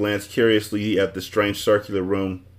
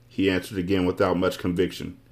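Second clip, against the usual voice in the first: ordinary delivery, nothing marked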